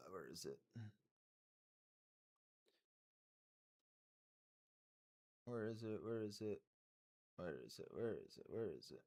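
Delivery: low, very soft searching mutter; muttering to oneself. Real, not acted. Streamer hunting for a comment, muttering to self. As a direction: repeat it under your breath while hunting.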